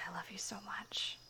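quiet breathy I love you; hushed loving whisper; soft whisper. Acted. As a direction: murmur it in afterglow. Afterglow whisper.

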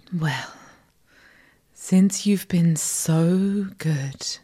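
ordinary talk

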